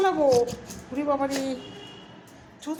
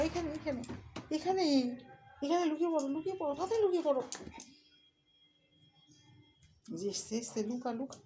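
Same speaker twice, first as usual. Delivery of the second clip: whispering while hiding; 9 dB quieter than usual. Pretend. Hiding the pet from grandpa.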